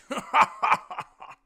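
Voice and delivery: loud, high belly laugh; big hearty cackle in character. A narrator's reading. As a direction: let out a booming, high laugh. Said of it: "He laughed".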